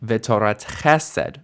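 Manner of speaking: V'torat CHE-sed, slow, joined as one; V'torat chesed as one phrase with light final "sed"; one unit, stem stress. Shown as a demonstration. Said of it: "pronounced as one unit".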